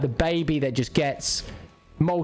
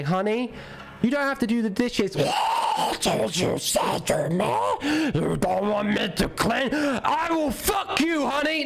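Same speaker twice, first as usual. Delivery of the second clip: high, shouted hammed-up wife; mock furious wife; 7 semitones higher, slower than usual. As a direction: play the outraged wife yelling. Acted angry Romanian wife.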